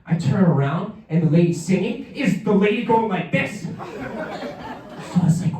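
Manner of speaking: normal talking voice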